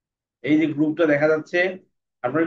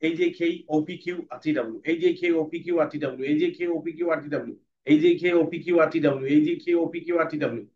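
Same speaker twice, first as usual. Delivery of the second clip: fast reading; faster than usual. Shown as a demonstration. Teacher demonstrating fast reading to class. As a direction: run the letters together fast. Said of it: "তারপরে পড়বো fast".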